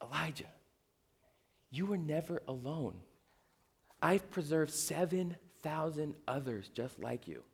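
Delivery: very soft, tender voice with pauses; hushed, unhurried comforting; gentle reassurance. Acted. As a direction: console him in a hush. God consoling Elijah, acted.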